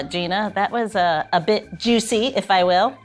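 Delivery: usual speaking voice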